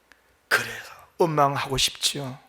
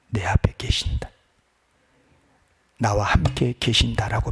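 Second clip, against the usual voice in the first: low, soft assurance with long pauses; hushed, intimate sermon; hushed preaching; 5 semitones lower, 3 dB quieter than usual. Acted. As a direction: speak it as a quiet declaration of faith. Hushed reassurance to congregation.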